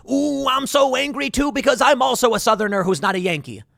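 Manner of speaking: mock him as a cartoon rebel; mock angry Southerner. Neighbor Southerner voiced in parody.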